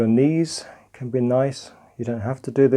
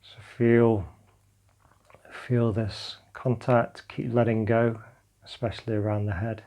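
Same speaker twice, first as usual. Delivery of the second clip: calm meditation guidance, drifting softer; guided meditation; 3 dB quieter than usual. Acted. Teacher guiding listeners lying on the floor through a body scan, calm meditation voice. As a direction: guide them gently into letting go.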